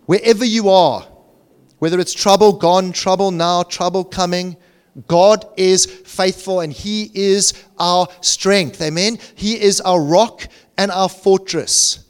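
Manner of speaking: high-energy preacher's rallying call; rallying preaching. Real, not acted. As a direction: proclaim it boldly, pushing the key words. Preaches at full force.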